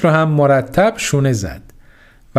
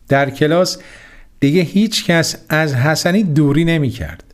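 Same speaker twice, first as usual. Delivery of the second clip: ordinary delivery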